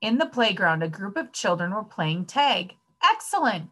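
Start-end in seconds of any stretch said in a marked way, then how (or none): 3.0-3.7 high, falling exclamation; excited cry. A narrator's reading. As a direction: burst out with childlike delight. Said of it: "cried Sammy".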